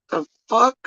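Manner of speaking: sharp high disbelieving "the fuck?"; stunned, high-pitched scoff; incredulous. Real, not acted. Disbelief at clip's claim.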